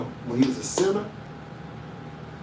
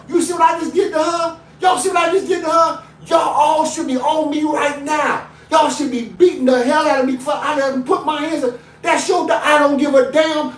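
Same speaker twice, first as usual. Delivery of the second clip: high, loud preaching shout at the sons; retold shouting; 12 semitones higher, 6 dB louder than usual. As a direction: bellow the order at your boys. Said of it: "I said".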